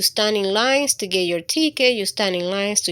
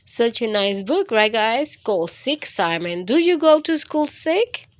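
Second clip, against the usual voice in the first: usual speaking voice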